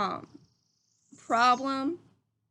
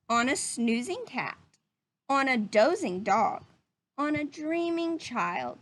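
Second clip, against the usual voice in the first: put-on reading to children; 3 dB louder than usual. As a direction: read it gently to little listeners. Reading picture book to children.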